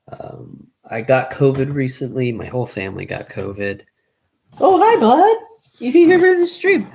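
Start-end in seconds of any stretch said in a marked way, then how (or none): 4.6-6.9 talking to a dog. Real, not acted. Greeting his dog on stream.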